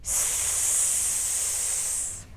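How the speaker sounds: S as a long unvoiced hiss; isolated s sound. Shown as a demonstration. Teacher models the S sound for the child, a soft hiss. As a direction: hiss the S alone, no vowel. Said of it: "A ver".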